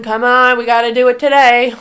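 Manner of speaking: loud self-motivating push; rally yourself, loudly; self pep talk. Real, not acted. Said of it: "pepping herself up".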